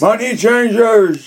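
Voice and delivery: high, long-drawn cry; mock yell. Echoing the angel who yelled at them, mock yell. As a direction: draw each word out in a high holler. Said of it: "he yelled at them".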